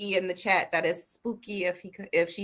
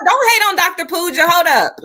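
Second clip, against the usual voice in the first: raised, high-pitched objection; loud protest; 9 semitones higher, 9 dB louder than usual. Real, not acted. Co-host jumping in to defend Doctor Pooja, loud protest. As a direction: cut in loudly, well above normal pitch.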